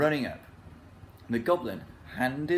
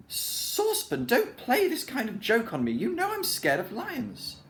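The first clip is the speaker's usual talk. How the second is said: much higher, frightened pleading; scared; 12 semitones higher than usual. A narrator's reading. Dame Washalot frightened of the lion, high alarmed plea. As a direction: beg him to stop, alarmed.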